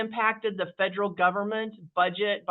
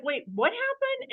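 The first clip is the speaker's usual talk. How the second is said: stunned disbelief; 14 semitones higher than usual. Retold or imitated. Retold shock after losing business.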